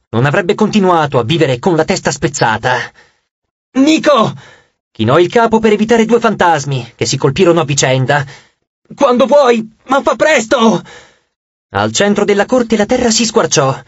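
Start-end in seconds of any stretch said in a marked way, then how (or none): 3.7-4.3 higher-pitched urgent call; sharp cry of a name, high and falling; urgent shout. A narrator's reading. Reina shouts to Nico in battle. 8.9-10.8 high-pitched, hurried battle call; raised, impatient call to an ally; urgent call. A narrator's reading. Urging Nico amid the fight.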